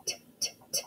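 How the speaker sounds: T, t, t as unvoiced pops; isolated letter sound. Shown as a demonstration. Teacher sounding out tissue, T as soft separate pops. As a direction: tap out a soft, voiceless "t" three times. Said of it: "What's the first sound you hear".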